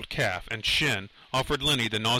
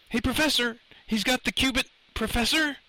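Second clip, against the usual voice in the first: high, urgent call for attention; alarmed calling; 12 semitones higher than usual. A narrator's reading. Lenny rousing the professor as the trooper leaves, high urgent calling. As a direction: call out in alarm, pitch far up.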